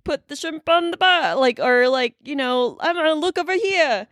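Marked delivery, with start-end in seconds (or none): 0.0-1.3 higher-pitched mock Aussie; bad Australian accent. Mud-pit hero calling Sheila, bad Australian accent. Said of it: "he's, like, in a mud pit". 2.8-4.1 high, quick mock Aussie; bad Australian accent. Describing an over-the-top hero, bad Australian accent. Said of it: "he's, like, in a mud pit".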